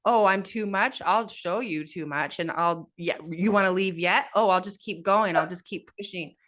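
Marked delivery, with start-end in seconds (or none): none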